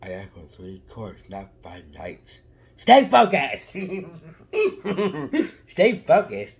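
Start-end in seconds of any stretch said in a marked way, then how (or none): 2.8-3.7 loud, high mock command; mock stern shout. Kid shouting at himself in mock sternness to stay focused. 5.7-6.5 loud, high shout through laughs; laughing shout. Pretend. Kid repeating his joke order, laughing.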